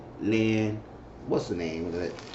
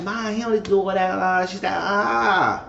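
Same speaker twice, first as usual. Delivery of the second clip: higher, whining daughter voice; whiny Grace; 9 semitones higher than usual. Retold or imitated. Grace complaining to her mother, higher whiny voice. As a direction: whine back at your mom. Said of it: "And Grace said".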